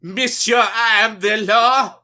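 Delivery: loud, slow mock French accent. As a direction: boom it out in an overdone French accent. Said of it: "your fucking French accent you always do".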